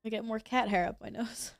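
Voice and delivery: ordinary delivery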